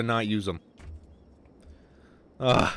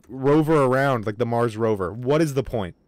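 normal talking voice

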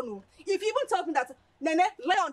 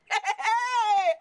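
laughing; 9 semitones higher, 6 dB louder, much slower than usual. Acted. Friend laughing excitedly.

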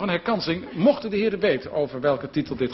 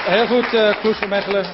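loud congratulation; 5 semitones higher, 6 dB louder than usual. Real, not acted. Host announces score over applause.

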